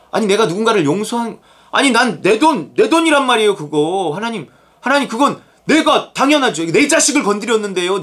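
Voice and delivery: high, aggrieved mock protest; talking back. Believer defending a grudge before God, talking back. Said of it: "하나님께 이렇게 대드는 판이에요".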